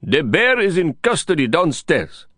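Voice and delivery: lightly voiced proud watchman; watchman's proud announcement. A narrator's reading. Watchman proudly informing Lin, lightly voiced. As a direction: announce it with mild pride.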